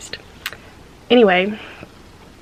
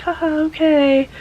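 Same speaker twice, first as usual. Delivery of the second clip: awkward fake laugh; 7 semitones higher, 6 dB louder, slower than usual. Forced reply to laughing tech.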